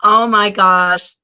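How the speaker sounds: ordinary delivery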